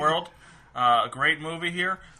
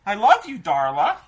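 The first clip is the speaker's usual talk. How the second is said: put-on kid voice; 6 semitones higher, 6 dB louder than usual. Quoting Little Rascals character.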